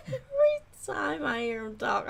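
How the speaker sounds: ordinary talk, nothing marked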